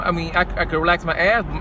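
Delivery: ordinary delivery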